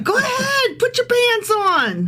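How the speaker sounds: very high mocking falsetto; sarcastic. Prophet mocking the doomed city's preparations, sarcastic. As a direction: squeal it as a taunting dare. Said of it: "it's sarcasm".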